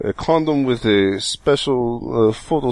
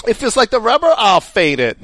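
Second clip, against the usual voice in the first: mock voice; 11 semitones higher, 5 dB louder than usual. Joking mock voice after condom.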